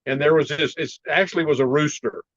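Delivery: ordinary delivery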